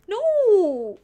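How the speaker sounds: loud, high, drawn-out wail of refusal; mock outrage. Real, not acted. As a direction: cry it out long and falling, playfully appalled.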